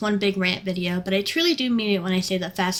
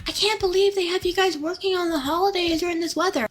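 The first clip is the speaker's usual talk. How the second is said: higher, put-on sugary customer voice; mock customer; 9 semitones higher than usual. Worker mimicking customers who pity her in the rain. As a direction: mimic their fake pity.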